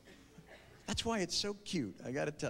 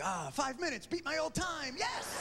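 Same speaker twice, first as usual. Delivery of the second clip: rising to a shouted yes; triumphant shout; 6 semitones higher than usual. Retold or imitated. Man finishing in five minutes, cheering himself on, building to a shout. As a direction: cheer like you just beat your record. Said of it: "Men are just".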